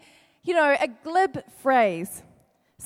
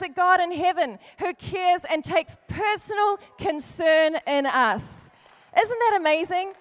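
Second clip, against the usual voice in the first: passionate preaching; 4 semitones higher than usual. Acted. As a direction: preach it with rising fervour. Preaching builds in force.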